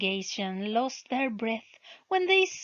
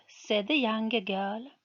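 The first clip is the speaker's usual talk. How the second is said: ordinary delivery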